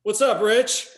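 imitated hearty greeting, high and loud; loud casual greeting. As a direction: boom it out like an unexpectedly casual elder. Elder's casual reply to soft greeting.

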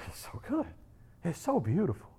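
very soft, savouring aside; hushed awe. Real, not acted. Preacher savouring the story of Jesus, hushed awe. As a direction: drop to a tender near-whisper.

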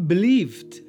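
normal talking voice

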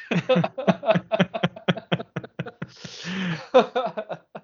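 long real burst of laughter; laughing. Real, not acted. Podcaster laughing at a dark comics theory, laughing hard. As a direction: crack up and keep it rolling.

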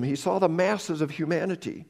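ordinary delivery

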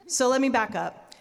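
normal talking voice

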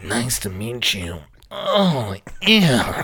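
slow, low, odd croaky imitation; slow imitation of a strange voice; Frogman voice. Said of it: "You sound like Kenny Kniff".

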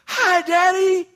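put-on very high, slow, sing-song child voice; little kids' greeting. Kids crawl out to greet dad.

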